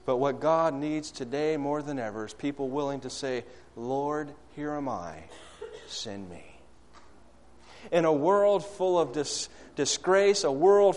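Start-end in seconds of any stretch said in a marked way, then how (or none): 3.8-6.4 hushed prayer. Acted. Closing prayerful refrain.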